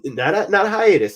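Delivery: sharp, loud, high denial; emphatic correction. Real, not acted. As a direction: insist it's not a hiatus.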